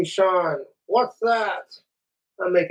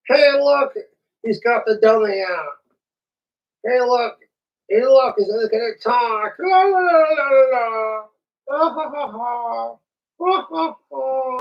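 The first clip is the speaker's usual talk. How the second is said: sing-song teasing in a raised voice; mocking high voice; 4 semitones higher than usual. Mocking the man with the dummy, high taunt.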